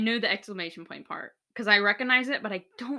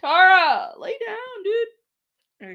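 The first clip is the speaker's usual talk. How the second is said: calling out; 11 semitones higher, 9 dB louder, much slower than usual. Real, not acted. Calling the dog to settle.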